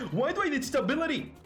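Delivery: ordinary talk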